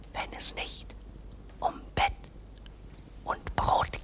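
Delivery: breathless unvoiced whisper. Clerk whispers his secret to K. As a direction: hush it almost to nothing.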